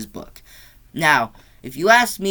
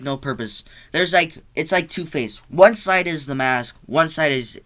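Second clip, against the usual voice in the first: ordinary delivery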